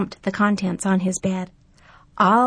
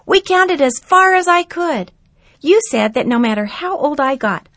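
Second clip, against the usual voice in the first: put-on a boy reminding his grandpa of stargazing; excited young boy; 2 semitones higher, 5 dB louder than usual. Narrator voices excited grandson.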